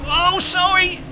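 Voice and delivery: high-pitched startled cry of apology. Real, not acted. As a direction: blurt it out high, just after bumping someone. Bumps another driver in race.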